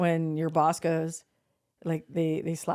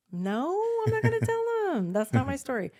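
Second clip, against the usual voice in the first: emphatic refusal; 10 semitones higher than usual. Retold or imitated. Firmly refusing to out her.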